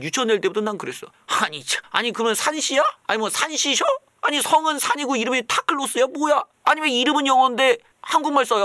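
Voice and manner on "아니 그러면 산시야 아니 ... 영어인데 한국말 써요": put-on kid's sceptical grumble. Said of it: "막 나 혼자 그랬지".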